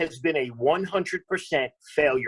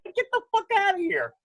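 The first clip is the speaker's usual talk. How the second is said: mock dismissal. Retold dismissal of offended woman.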